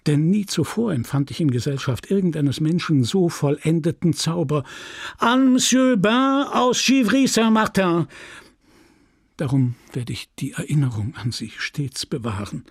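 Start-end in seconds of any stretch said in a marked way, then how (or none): 5.2-8.1 high-pitched, ringing prize announcement; chairman calling out. Acted. Chairman awards a gold medal to a farmer, calling out. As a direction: proclaim it to a whole crowd.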